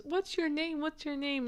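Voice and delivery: imitating a guy baby-talking a lizard; baby-talking pet store guy. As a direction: coo at the lizard like it's a puppy. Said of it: "And he goes".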